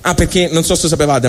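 usual speaking voice

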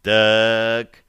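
slow, drawling single word; drawn out. A narrator's reading. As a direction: stretch the word out thoughtfully. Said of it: "протянул Остапий".

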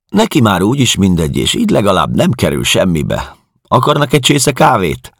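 high, loud, brisk caretaker woman; talkative caretaker. Acted. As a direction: rattle it out as the matter-of-fact caretaker. Narrator voices the talkative caretaker. (magas, hangos, szószátyár házmesternő)

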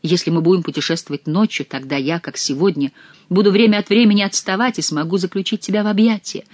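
ordinary delivery, nothing marked

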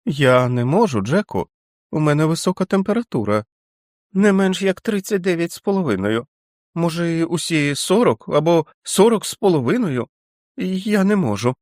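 put-on the feverish boy refusing to leave; whining feverish child. A narrator's reading. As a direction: whine it like a sick child. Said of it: "заперечив Річард".

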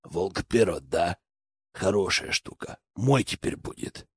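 low, hushed thug with short pauses; villain gang leader. A narrator's reading. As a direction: gloat quietly over a seized knife. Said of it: "Череп сверкнул кривыми коричневыми зубами".

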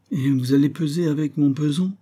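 high, plaintive question; suspiciously pitiful whine. A narrator's reading. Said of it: "d'un ton si plaintif".

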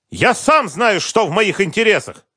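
high, loud snapping retort; furious retort. Acted. Businessman who cannot calm down, furious shout. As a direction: fling it back at him. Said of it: "никак не мог успокоиться".

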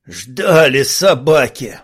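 rasped curse, slow; hoarse. Acted. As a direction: croak it out slowly. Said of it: "прохрипел Мосс".

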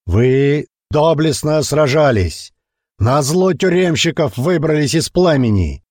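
rousing orator, high and slow; booming speech. A narrator's reading. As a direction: thunder it out to the crowd. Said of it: "гремел голос Черепа".

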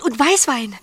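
normal talking voice

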